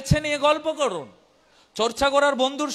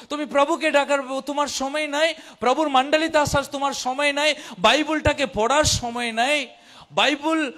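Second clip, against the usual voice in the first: normal talking voice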